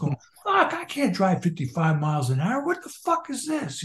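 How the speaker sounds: high, mock-exasperated cry; exasperated outburst. Retelling the singer's rage at the speed limit, exasperated. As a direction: act out indignant disbelief, voice leaping up.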